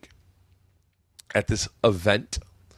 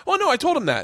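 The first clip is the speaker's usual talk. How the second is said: much higher, breezy wife voice; imitating wife; faster than usual. Mimicking his wife to the friend, high and breezy. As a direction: pitch way up, airy and unbothered. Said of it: "she goes".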